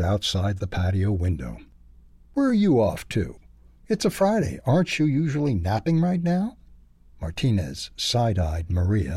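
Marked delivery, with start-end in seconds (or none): 2.3-6.6 high, chirpy little bird voice; a bird calling down, curious and high. A narrator's reading. Bird Maria quizzing the dog, high and chirpy.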